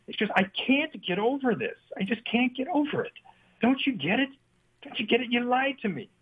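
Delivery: high, wounded mock plea; hurt Jill. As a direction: imitate a hurt wife's pleading. Jill hurt, confronting Jack.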